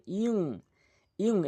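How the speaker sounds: ইউং with a hidden nasal ng; ikhfa nasal. Shown as a demonstration. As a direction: hum the ng of ইউং. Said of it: "ইকফা গুণনা করতে হবে".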